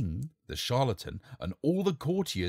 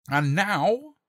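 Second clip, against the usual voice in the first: louder, higher showman call; grand announcement; 10 semitones higher, 8 dB louder than usual. Acted. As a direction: build suspense for the court. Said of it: "said the king".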